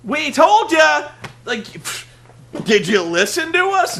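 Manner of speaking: loud, slow, drawn-out gloating yell; mock gloating shout. Heels shouting at the crowd on Impact, loud mock gloating. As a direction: shout it like a smug heel rubbing it in. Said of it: "be like".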